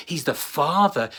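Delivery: ordinary delivery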